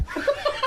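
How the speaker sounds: high, soft burst of laughter. Real, not acted. Guest laughs at his own hotel story, high laugh. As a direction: crack up mid-story.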